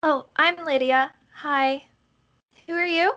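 put-on innocent, inquisitive child; little girl. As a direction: introduce yourself up high like a young girl. Game master plays ten-year-old girl.

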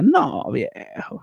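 very high, long-stretched exclamation; drawn-out dismay. Real, not acted. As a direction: stretch it out high in exasperated protest. Exclaims over absurd campus mix-up.

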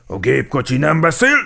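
loud, high officer's rebuke; commanding telling-off; reprimand. A narrator's reading. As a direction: call the car to order sharply. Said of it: "utasította rendre Gepárd Géza".

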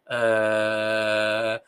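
long low monotone "eh"; throat sound. Shown as a demonstration. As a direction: hold a steady hum from the throat. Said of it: "Hace sonido con la garganta". (sonido grave y sostenido de garganta)

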